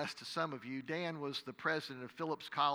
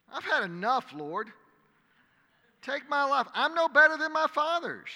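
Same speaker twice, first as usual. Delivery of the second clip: loud, much higher anguished plea; desperate cry; 11 semitones higher, 10 dB louder than usual. Acted. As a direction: cry out to God in despair. Elijah begging God to die.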